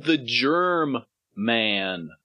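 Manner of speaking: "germ man" said slowly as two separate words, "germ" then "man". Shown as a demonstration. Correcting the mishearing, "germ man" spelled out slowly. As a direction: drag out "germ man" so it is clearly not "German". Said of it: "I said".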